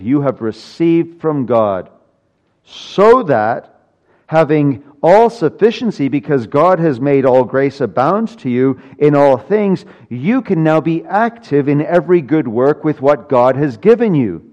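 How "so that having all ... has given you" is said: louder and higher preaching build; rising climax. Acted. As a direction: build to full force.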